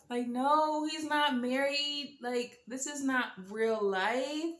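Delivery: slow, high-pitched denial; shocked disbelief. Retold or imitated. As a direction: draw it out high, refusing to believe it. I'm still shocked.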